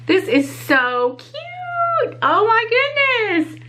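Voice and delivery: high, slow cooing over something cute; cooing delight. Real, not acted. Admiring a tiny charm for her bag, slow high cooing. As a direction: gush slowly and high.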